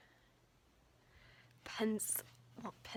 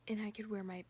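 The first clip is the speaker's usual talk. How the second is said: ordinary talk, nothing marked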